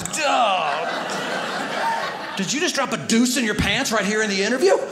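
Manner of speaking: incredulous boss catching the accident mid-interview; mock shocked interviewer.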